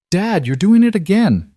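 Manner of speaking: put-on high, loud kid voice with a mouthful; mouth full. A narrator's reading. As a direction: talk through a bite of pancake. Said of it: "through a mouthful of pancake".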